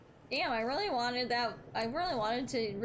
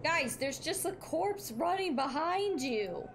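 alarmed exclamation; 7 semitones higher than usual. Real, not acted. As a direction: warn them in high-pitched disbelief. Alarmed at corpse running behind players.

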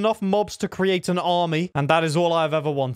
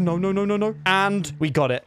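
ordinary delivery

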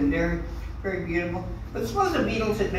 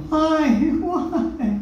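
mock lament for the microphone; 8 semitones higher, 5 dB louder than usual. Pitying the lonely microphone.

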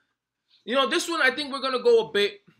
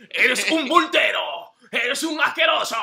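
mock shout. Real, not acted. Teasing shout at friend.

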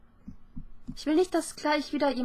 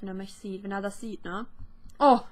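ordinary talk

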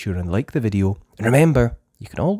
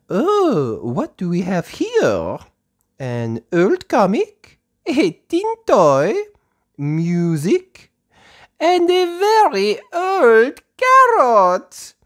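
high, slow, fluttery French teacher voice; a gentle teacher speaking slowly to little children; Madame Gazelle voice; 14 semitones higher, 8 dB louder, much slower than usual. Acted. Teacher to her playgroup, high and slow.

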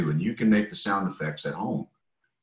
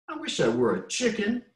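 higher, wishful chick voice; wistful big chick; 13 semitones higher than usual. Acted. Reading aloud, the big chick privately wishes. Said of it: "thought the big chick".

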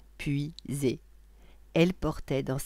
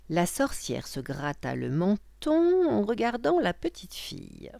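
ordinary delivery

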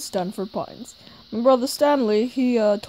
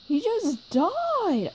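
stunned grief; 10 semitones higher, much slower than usual. Acted. Character learns a death.

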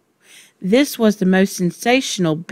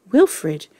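ordinary delivery, nothing marked

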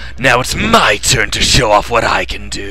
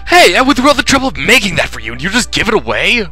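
excited high voice; 11 semitones higher than usual. Acted. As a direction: squeal it out with glee.

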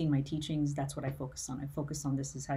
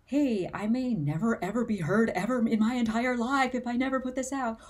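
higher, louder quoted worry; a put-on worried voice, raised; mock anxious inner voice; 6 semitones higher, 5 dB louder than usual. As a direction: voice the fearful self, pitched up and loud. Acting out a fearful inner voice.

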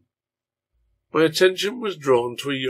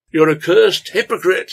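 loud, high, angry jeer; called-out accusation; 7 semitones higher, 9 dB louder than usual. A narrator's reading. Young man calling after a departing man, accusing. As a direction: hurl the insult after him. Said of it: "he called after Lyon".

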